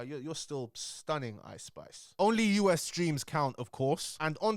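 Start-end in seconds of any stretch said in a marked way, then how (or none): 0.0-2.1 soft aside. Pretend. Aside compliment to [name].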